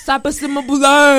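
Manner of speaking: loud, hammed-up outsider saying it; overdone imitation of non-Haitians; mocking outsider greeting. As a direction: blurt it out loud like a clueless outsider. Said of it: "They, it just".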